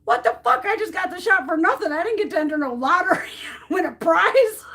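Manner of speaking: high, loud, outraged disbelief; mock-outraged rant, half laughing; indignant rant. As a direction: vent like you got cheated out of a prize. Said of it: "I'm like".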